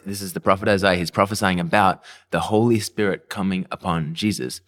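usual speaking voice

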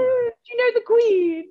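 high, loud gushing American tourist; mock American voice. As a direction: squeal it like an excited American fan. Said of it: "They're like".

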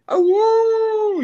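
high, loud wolf howl; imitated wolf howl. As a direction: throw your head back and howl like a wolf. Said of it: "uivo que fala".

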